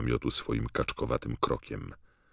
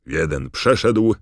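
loud announcer's roar, pitched up and slowing; big raised voice, stretched at the end; Bagman roaring; 4 semitones higher, 10 dB louder than usual. A narrator's reading. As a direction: boom out the tally to the stands. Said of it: "ryknął Bagman".

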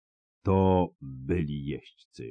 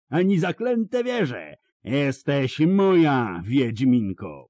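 gloating roar; 9 semitones higher than usual. A narrator's reading. Bonhart claims Ciri as his prey, roaring. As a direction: savour every word of the threat. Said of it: "zaryczał Bonard".

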